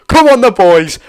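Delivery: loud rallying cheer; loud fan chant-like cheer. Real, not acted. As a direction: roar the team on. Cheering his team at kickoff.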